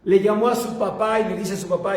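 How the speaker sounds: ordinary talk, nothing marked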